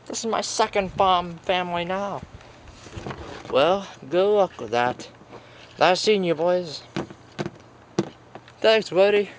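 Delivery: usual speaking voice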